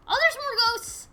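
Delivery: high, loud cry of discovery; startled exclamation. Real, not acted. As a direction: call out a sudden sighting. Player spots more ghosts.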